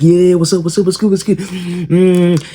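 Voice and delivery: high, mocking what's good; taunting jocks. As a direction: get up in his face with a mocking taunt. Guys taunting the kid.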